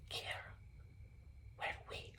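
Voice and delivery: barely audible whisper; hushed, furtive question to a friend; secretive whisper. Retold or imitated. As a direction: whisper it so the police can't hear. Secretly asking friend while police near.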